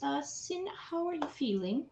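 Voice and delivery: gentle concern. Acted. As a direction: ask tenderly, as if at a bedside. Nana checks gently on Sin.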